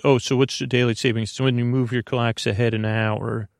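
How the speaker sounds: usual speaking voice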